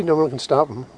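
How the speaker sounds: normal talking voice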